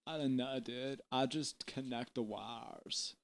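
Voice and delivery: hushed, deep, unbothered crew voice; laid-back stage technician. Acted. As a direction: murmur it low like a dozy old stagehand. Plays Jet, the stage manager.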